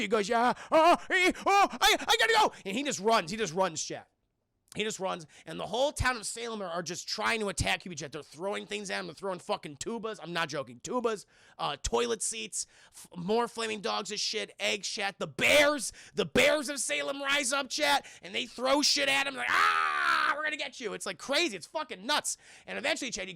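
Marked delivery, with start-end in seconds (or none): 0.3-2.5 flustered, higher and louder, tripping over the words; panicked excuse. Retold or imitated. Hubie panics when the town confronts him, voice jumping higher. 19.5-20.9 hammed-up high roar of a mob; mock attack yell. The bears of Salem chase Hubie, mock roaring yell.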